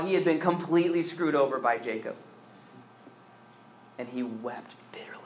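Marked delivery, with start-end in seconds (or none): 4.0-5.1 whispered. A narrator's reading. Narrating Esau's bitter weeping.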